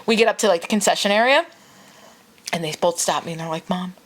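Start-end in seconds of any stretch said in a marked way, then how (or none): 3.7-3.9 soft, whispery plea; hushed little-kid voice trailing into a whisper; imitated tired child. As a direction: imitate sleepy kids gently confessing. Tired children confessing to their mother.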